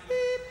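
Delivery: high imitated answerphone beep; high machine beep. Comic imitating nan's answerphone, high beep.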